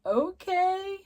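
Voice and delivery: high, stretched-out, dubious okay; imitated awkward okay. Retelling the Doctor and Ruby meeting babies, awkwardly high. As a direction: mimic two people unsure how to react.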